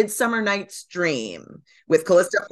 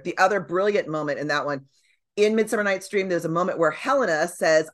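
usual speaking voice

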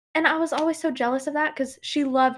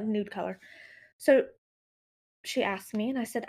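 ordinary talk, nothing marked

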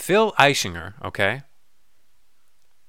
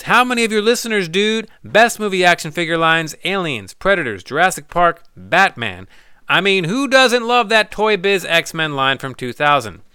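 mock letter voice; 6 semitones higher, 7 dB louder than usual. Reads listener letter aloud.

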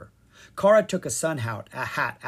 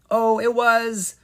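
high, loud, slow stalling; slow nervous hesitation; flustered; 10 semitones higher, 9 dB louder, slower than usual. A narrator's reading. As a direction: cover the secret clumsily. Leon hiding a secret.

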